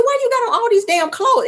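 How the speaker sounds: high disbelieving cry; incredulous high exclaim. Retold or imitated. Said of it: "I said".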